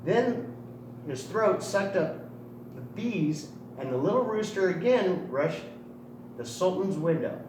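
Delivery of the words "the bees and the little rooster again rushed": ordinary delivery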